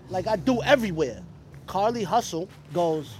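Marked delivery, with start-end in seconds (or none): none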